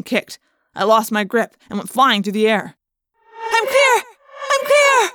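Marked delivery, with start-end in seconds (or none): 3.5-5.1 very high, loud happy shout; relieved call. Acted. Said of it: "Cassie called".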